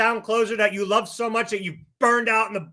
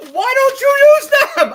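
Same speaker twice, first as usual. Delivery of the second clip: furious yell, much higher and louder; 10 dB louder than usual. Real, not acted. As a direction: shout it out in real rage. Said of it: "I said I wasn't gonna yell today".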